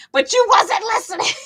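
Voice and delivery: high-pitched comic reproach; squealed, playful telling-off; mock scolding. Mock scolding someone who ignored warnings.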